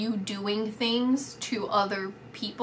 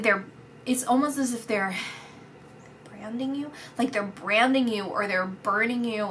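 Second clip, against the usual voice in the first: ordinary talk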